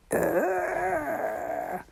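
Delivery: high exclamation, groan into loud cry; dismissive high-pitched cry. A narrator's reading. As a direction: cry out against a blast of cold. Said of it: "she cried".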